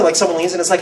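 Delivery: normal talking voice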